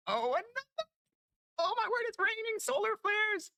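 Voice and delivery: very high, stretched-out squeal; ecstatic squeal. Real, not acted. Player sees another rare drop in a row, ecstatic squeal. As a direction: squeal in disbelief at your luck.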